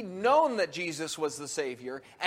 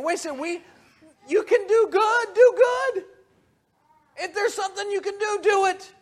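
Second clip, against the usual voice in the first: much higher, louder, pressing on "do good"; urgent pleading; 12 semitones higher, 6 dB louder than usual. Acted. Jesus urges the quibbling crowd to do good, high and urgent. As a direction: push them hard to see the good they could do.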